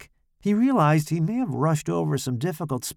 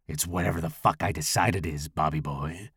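low menace; 7 semitones lower, 3 dB quieter than usual. A narrator's reading. Larry menaces Robert.

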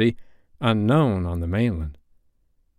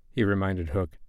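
ordinary delivery

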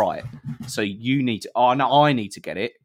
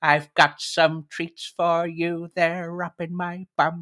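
higher, slow, silly put-on voice; 7 semitones higher, much slower than usual. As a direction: drawl it out like a creepy host. Silly treat-giving voice.

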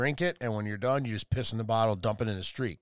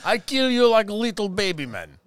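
slow, hammed-up Russian-accented dragon; drawn-out villainous threat in a fake Russian accent; mock Russian accent; 4 semitones higher, 3 dB louder than usual. Dragon threatening to breathe fire, mock Russian accent. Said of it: "I think the dragon was Russian".

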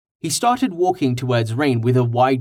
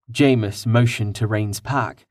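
plain reading voice

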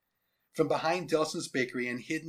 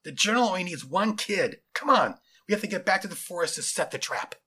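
raised, insistent soldier voice; louder, higher, impatient command; yelled back; 5 semitones higher, 5 dB louder than usual. A narrator's reading. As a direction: order your partner along loudly. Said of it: "yelled back the taller soldier".

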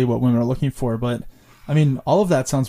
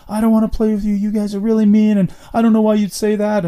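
put-on higher hurt kid voice; upset eight-year-old; 8 semitones higher, 4 dB louder than usual. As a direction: complain like a wounded little boy. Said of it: "he's eight and he's like".

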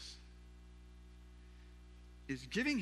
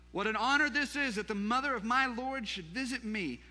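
high, loud outburst of honor; acted joyful cry of welcome; exclaiming; 4 semitones higher, 4 dB louder than usual. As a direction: exclaim it in a raised, lifted voice. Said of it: "a loud cry and exclaimed".

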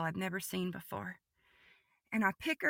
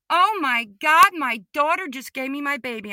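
loud, high exclamation of wonder; high, excited cry, reliving the moment; retold amazement; 5 semitones higher, 10 dB louder than usual. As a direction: burst out with the overwhelming news. Reliving joyful realization after vision.

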